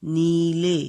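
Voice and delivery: نیلے called out loudly as a model; model word. Shown as a demonstration. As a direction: say نیلے clearly for pupils to copy. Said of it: "نیلے میں پڑھ رہی ہوں نا".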